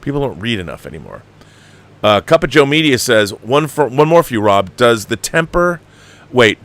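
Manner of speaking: usual speaking voice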